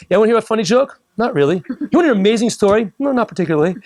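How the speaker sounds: eager teaser and bored refusal; mock excited pitch. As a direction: play both sides of a pushy offer. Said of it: "the person's so excited".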